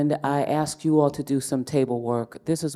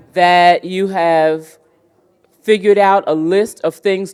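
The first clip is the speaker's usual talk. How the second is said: calling the room back; 6 semitones higher, 9 dB louder than usual. Real, not acted. Host rallies the room after table discussion, calling out loudly. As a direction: project big and high to a whole room.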